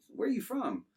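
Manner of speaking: a light imitation of her asking; curious woman. Woman on the dock asks the boy, lightly imitated. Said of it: "she goes".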